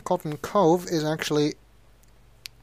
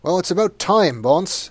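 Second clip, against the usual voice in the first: gruff jailer, raised pitch and louder; jailer exclaiming; 6 semitones higher, 5 dB louder than usual. Acted. Jailer greets officer Bonds, exclaiming loudly. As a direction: call out to the cop like a fed-up jailer. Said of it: "exclaims the jailer".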